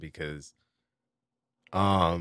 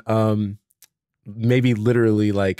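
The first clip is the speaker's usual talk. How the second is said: usual speaking voice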